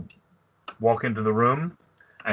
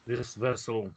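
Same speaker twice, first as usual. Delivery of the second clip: sighing grandfather; 2 semitones lower, 3 dB quieter than usual. Acted. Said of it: "sighs and he says".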